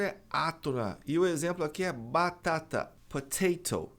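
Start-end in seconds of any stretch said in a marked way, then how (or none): 3.1-3.9 "potato" with both Ts crisply pronounced; British T. Shown as a demonstration. Teacher giving the British pronunciation, both Ts sounded. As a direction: say "potato" keeping each T a true T. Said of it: "Essa é a pronúncia do britânico".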